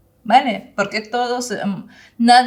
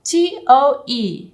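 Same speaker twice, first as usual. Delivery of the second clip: letters "T O E" spelt out slowly and loud; slow spelling; 4 semitones higher, 5 dB louder, much slower than usual. Shown as a demonstration. Teacher spelling toe for the class, slow and loud.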